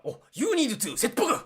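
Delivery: loud, high-pitched mock samurai with a Japanese accent. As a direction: shout it like an over-the-top samurai radio DJ. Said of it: "in a samurai accent".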